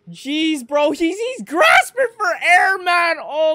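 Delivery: high, loud, slow cry of dismay; anguished shout. Real, not acted. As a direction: wail it out over the scene. Reacting to choking scene.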